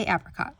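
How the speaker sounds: "apricot" starting like "apple"; short-a apricot. Shown as a demonstration. Telling viewers her own way, "apricot" with short a. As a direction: say "apricot" as APP-ricot. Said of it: "curious how you pronounce it".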